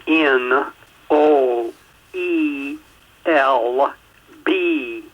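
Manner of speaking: each letter set apart by a pause; slow spelling. Shown as a demonstration. Host spelling website for listeners, slow and deliberate. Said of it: "spell that website address for everyone a little bit slower".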